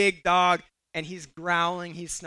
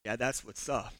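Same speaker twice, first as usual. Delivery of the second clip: low, very soft mock swagger; understated acted-out bragging; cool boast; 9 semitones lower, 10 dB quieter than usual. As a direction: play it cool and quiet after a victory. Boy boasts after dog flees.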